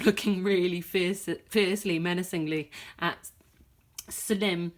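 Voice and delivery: plain reading voice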